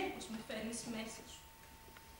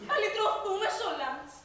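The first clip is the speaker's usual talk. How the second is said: higher, louder, slow laughing rapture; ecstatic laughing; 7 semitones higher, 7 dB louder, slower than usual. Acted. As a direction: savour the redemption in laughter. Ecstatic vision of redemption. (εκστατικό γέλιο)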